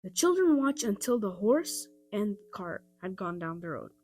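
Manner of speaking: ordinary delivery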